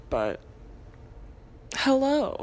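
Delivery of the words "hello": higher, louder cheesy hello. Shown as a demonstration. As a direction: ham up the greeting. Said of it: "that was the cheesiest thing I've probably ever said".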